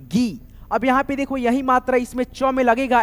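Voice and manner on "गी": गी said gee, lower pitch; gi demo. Shown as a demonstration. Teacher quizzes, then answers gee. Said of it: "क्या बोलेंगे दोस्तों".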